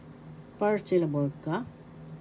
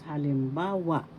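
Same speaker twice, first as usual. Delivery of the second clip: halimbawa with weight on BA, the third syllable; stress on third syllable. Shown as a demonstration. Teacher telling learners how to pronounce it, halimbawa stressed on BA. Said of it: "the third syllable has the accent".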